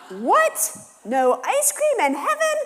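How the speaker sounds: high, loud mock-outraged kid voice. Kids outraged in van.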